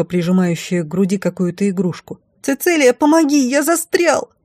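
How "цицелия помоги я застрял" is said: put-on very high child's voice; high-pitched frightened child; boy calling for help. A narrator's reading. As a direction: plead as a trapped little boy. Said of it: "слабым голосом отозвался из-за стены огня мальчик".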